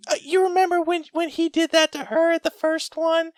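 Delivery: hammed-up gossiping old lady; Southern old lady whispering to a friend mid-movie. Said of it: "old Southern ladies, and they're just like".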